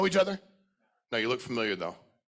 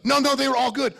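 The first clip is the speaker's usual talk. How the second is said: eager, louder and higher protest; mock defensive reassurance; 8 semitones higher, 6 dB louder than usual. Speaker mocks an uneasy attendee with a higher, louder protest. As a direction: play an uneasy audience member rushing to say all were good. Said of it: "this uncomfortableness of".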